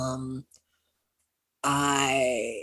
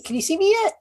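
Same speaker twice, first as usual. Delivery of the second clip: much higher, louder question called into fog; lost young man shouting to approaching riders; calling out; 6 dB louder, faster than usual. Acted. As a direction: call out hoping to be seen. Character calling out through fog.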